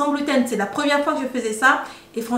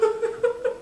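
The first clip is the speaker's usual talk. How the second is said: high giggle; 10 semitones higher, 7 dB quieter than usual. Real, not acted. Laughing at herself eating.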